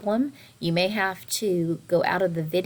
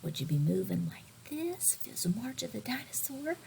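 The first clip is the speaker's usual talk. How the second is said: soft, breathy whisper to the class; teacher hushing the class to imagine; whispered question; 3 semitones higher, 10 dB quieter than usual. Shown as a demonstration. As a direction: lean in and whisper it. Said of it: "show me".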